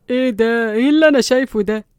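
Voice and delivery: loud, stunned outburst; loud shocked yelling. A narrator's reading. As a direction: cry out at the sight in shock. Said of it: "يوسف اتكلم بصدمة".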